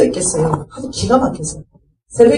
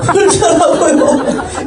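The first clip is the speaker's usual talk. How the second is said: drawn-out laughing retort; 8 semitones higher, much slower than usual. Retold or imitated. Buddhist grandmother loses patience.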